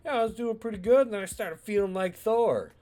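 high, loud bragging; cocky boast. Retold or imitated. Uncle bragging about himself, high cocky boast. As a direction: brag like a proud hero.

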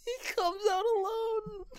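high, wailing fake sob on every word; mock crying. Friend asks him to say the other line, mock crying. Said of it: "You gotta say the other one".

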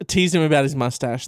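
usual speaking voice

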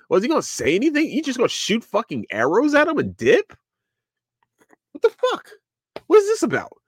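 high-pitched incredulous questioning; hammed-up confused frustration; baffled viewer. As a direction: squeak it out in mock disbelief.

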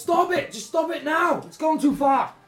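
high, loud, frantic shouting; Gretsch Shotsinker. Acted. Villain calling off the fight, frantic high shout. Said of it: "Gretsch Shotsinker goes".

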